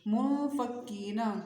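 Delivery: slow منفکینہ, nasal hum before the ف, long ee; ikhfa nasalisation. Shown as a demonstration. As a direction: draw out منفکینہ with a hidden, nasal n. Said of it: "نرمی سے اخفا کریں گے".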